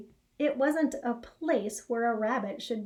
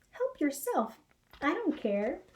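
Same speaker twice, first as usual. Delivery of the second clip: lazy cow voice; 4 semitones higher than usual. Acted.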